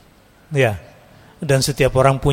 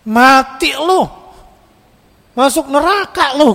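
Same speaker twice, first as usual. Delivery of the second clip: much higher, put-on voice damning someone to hell; mock condemning zealot; 12 semitones higher, 4 dB louder, slower than usual. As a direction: sneer like a self-righteous judge. Mocking a zealot condemning others.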